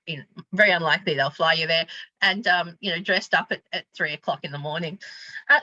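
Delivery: normal talking voice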